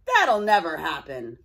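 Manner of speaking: put-on loud, scornful old streetcar jeering; a grumpy old man mocking a little car; gruff old-timer scoffing. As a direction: sneer it out like a scruffy old-timer. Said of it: "blurted out Old Mac".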